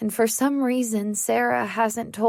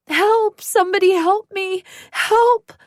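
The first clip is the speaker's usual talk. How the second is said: high, loud screams for help; throat-tearing cry for help; desperate screaming; 9 semitones higher, 6 dB louder than usual. Acted. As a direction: scream for help at the top of your lungs. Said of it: "I scream so loudly it hurts my throat".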